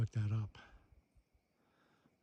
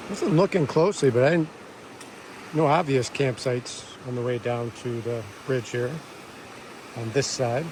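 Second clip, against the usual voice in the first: raised voice over rushing water; by a babbling creek; 6 dB louder than usual. Real, not acted. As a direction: talk up over the creek noise. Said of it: "You can hear the creek".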